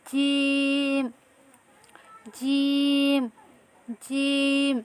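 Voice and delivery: জিম drawn out very slowly, middle of tongue on the palate; slow letter demo for students; Arabic jim. Shown as a demonstration. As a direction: stretch জিম long and slow. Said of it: "জিহ্বার মধ্যখান উপরের তালুর সঙ্গে লাগিয়ে".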